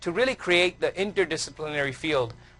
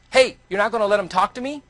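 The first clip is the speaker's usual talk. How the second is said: loud, higher mock yell; retold scream; 6 semitones higher, 5 dB louder than usual. Heckler shouting at the mentor walking the speaker away, loud mock yell. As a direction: throw the heckler's angry challenge across the room. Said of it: "this guy screams at him".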